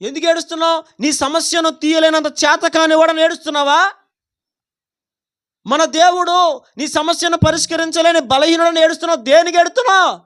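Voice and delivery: high, forceful preaching. Real, not acted. Challenges the congregation. (గొంతు పెంచి నిలదీస్తూ ప్రసంగం)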